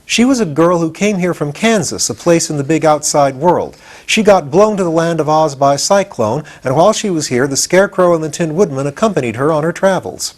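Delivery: put-on a touch of young know-it-all enthusiasm; lightly voiced boy Tip. A narrator's reading. Boy Tip telling Pumpkinhead about the Land of Oz, eager.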